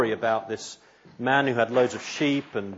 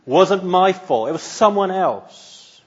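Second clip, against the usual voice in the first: self-justifying; 7 semitones higher, 7 dB louder than usual. Retold or imitated. Preacher mimics a sinner justifying himself, in an indignant mock protest. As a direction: push the blame away indignantly. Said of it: "Do you try and justify yourself?".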